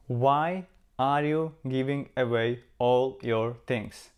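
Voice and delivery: normal talking voice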